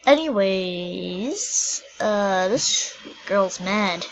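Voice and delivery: very slow and drawn out; slow drawl. Real, not acted. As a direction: stretch every word lazily.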